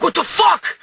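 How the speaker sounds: high-pitched outraged outburst; freaking out. Retold or imitated. Man jumps up after the fight, freaking out. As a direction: blurt it high, in retold shock. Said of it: "I was freaking out".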